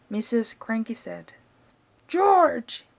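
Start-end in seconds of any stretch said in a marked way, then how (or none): 2.1-2.9 loud, higher shout of the name; excited father shouting for his son. A narrator's reading. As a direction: call the boy sharply. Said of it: "Mister Cranky shouted".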